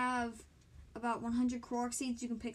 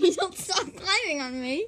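laughing complaint; 6 semitones higher, 8 dB louder than usual. Real, not acted. Sisters wrestling, laughing.

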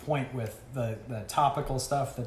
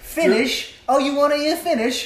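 playful excitement; 12 semitones higher, 9 dB louder than usual. Real, not acted. Teasing, mock-eager offer.

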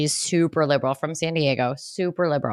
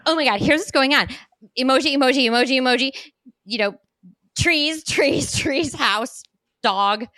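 put-on chattering little girl; 6 semitones higher than usual. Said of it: "She's like".

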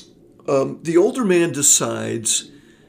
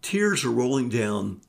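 normal talking voice

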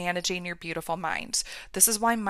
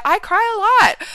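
much higher and slow, stretching out "I cry a lot"; stretched emphasis; 11 semitones higher, 7 dB louder than usual. Real, not acted. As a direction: draw out "I cry a lot" high and slow, half laughing at herself. Repeats her crying confession.

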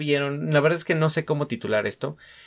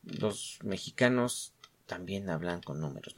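much softer, lower trial of the title "Los mexicanos también hablan con números"; quiet title try; 6 semitones lower, 12 dB quieter than usual. Shown as a demonstration. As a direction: murmur the title to test it. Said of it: "No sé cómo titular esta mierda".

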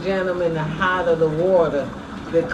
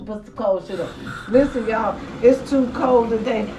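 normal talking voice

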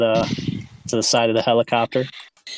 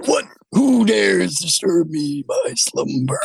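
slow booming android voice, pitched up; sleeping ancient god; 8 semitones higher, slower than usual. Acted. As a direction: wake up grandly offended, slowly.

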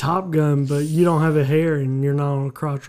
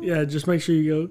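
ordinary talk, nothing marked

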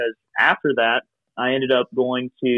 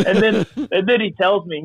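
higher-pitched words through laughter; laughing; 9 semitones higher than usual. Real, not acted. Resuming the recruiter story, real laughing. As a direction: carry on the story while still laughing.

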